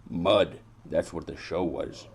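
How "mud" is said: loud, punched single word; emphatic reveal. Acted. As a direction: hit it hard as the bitter answer. Answers own dramatic question.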